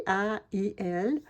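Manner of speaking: slow, letter by letter; spelling out letters. Shown as a demonstration. Crafter spelling for viewers, slow letter by letter. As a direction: spell "A I L" out slowly, one letter at a time. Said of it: "On a aïe".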